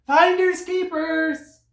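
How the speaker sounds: very high, slow bird cry; excited bird. A narrator's reading. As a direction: sing it out like a little bird. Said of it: "cried the bird".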